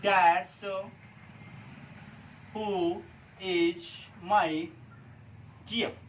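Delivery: long pauses between each word; dictation pauses. Shown as a demonstration. Teacher builds the sentence for students with long dictation pauses. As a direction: spell out the sentence one word at a time. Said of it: "कैसे बनाएंगे हम guys?".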